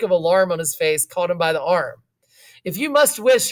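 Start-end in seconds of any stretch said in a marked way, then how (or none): 2.6-3.5 mildly urgent, higher-pitched demand; a touch of insistence. A narrator's reading. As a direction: press the point with lifted pitch. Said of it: "he demanded".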